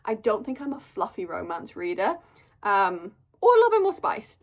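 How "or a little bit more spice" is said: high, loud gush over spice; a giddy higher louder burst; excited. Real, not acted. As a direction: squeal it with glee. Gushing over wanting spice.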